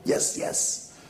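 whispered, excited director approval; imitated delighted director. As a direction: hush it like an enraptured director. Delighted director, voiced in story.

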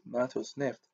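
ordinary delivery, nothing marked

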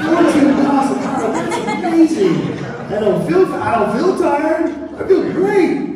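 high, loud, giddy self-congratulation; mock amazed boasting. Preacher retells marveling at his walking speed, mock amazed boasting. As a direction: play up the delighted disbelief at your own speed.

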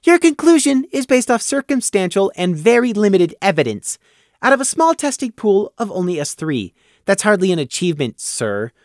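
put-on high, precise, pedantic young voice; prim young lamb. Prey lectures Gloom, high and fussily precise. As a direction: correct the sergeant primly.

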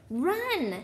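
high, urgent cry to run; a contained yell, pitched well up. A narrator's reading. Harry to his fleeing friends, urgent yell. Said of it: "Harry yelled".

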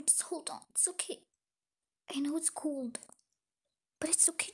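soft soothing whisper; comforting whisper. Acted. Big sister comforting little sister in the cold, whispered. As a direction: hush her gently.

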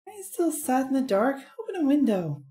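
high, quick lizard voice; sly squeaky reptile sidekick. Acted. Player voices Billy to captain.

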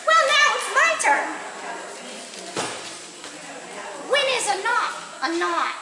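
high, bright-eyed little bunny, pleased with itself; tiny bunny voice. Acted. Little bunny Sword answering Kristoff's riddle, squeaky and proud.

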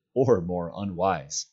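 usual speaking voice

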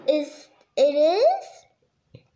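very slow, teary disbelief; mild sniffle, hesitant and hopeful; sniffling question. Acted. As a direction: sad moose checking if it is true. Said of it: "Barry sniffled".

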